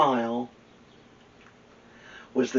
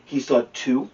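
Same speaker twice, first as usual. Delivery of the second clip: ordinary delivery, nothing marked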